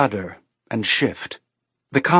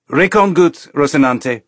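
put-on higher, louder Belter Ceres accent; Ceres station accent; 3 semitones higher, 5 dB louder than usual. A narrator's reading. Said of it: "The accent was pure Ceres station".

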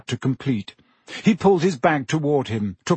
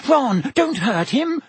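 very high, drawn-out alarmed squeal; 10 semitones higher than usual. A narrator's reading. Hermione begging Ron to spare her cat, high squeal. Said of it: "squealed Hermione".

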